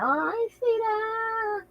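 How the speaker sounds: high, slow, whiny fake innocence; mock innocent denial. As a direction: play dumb in a high put-on voice. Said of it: "act like ain't never nothing happened".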